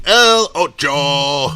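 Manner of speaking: slow, loud, drawn-out mock ring announcer call; hammed-up announcer call of the bid. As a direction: stretch it out like a boxing intro. Playful nickname for bid eight.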